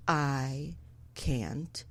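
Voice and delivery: very slow, low, soft; stoned paralysis. Retold or imitated. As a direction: drag each word out as if frozen. Acting out being too high.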